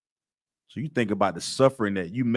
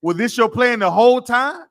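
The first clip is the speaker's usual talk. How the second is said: much higher and louder, teasing accusation; mock accusation; 11 semitones higher, 7 dB louder than usual. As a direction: accuse Moses in a hammed-up whine.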